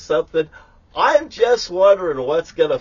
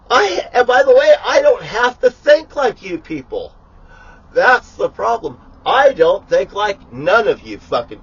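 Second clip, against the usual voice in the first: ranting shout; 6 semitones higher, 6 dB louder than usual. Real, not acted. Ranting grandly at everyone.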